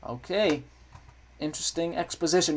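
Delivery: ordinary talk, nothing marked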